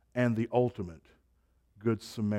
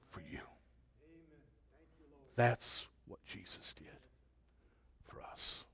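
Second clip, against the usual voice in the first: soft, fading to a whisper; hushed emphasis; 17 dB quieter than usual. Real, not acted. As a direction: let it sink away into a whisper. Hushed close after forceful preaching.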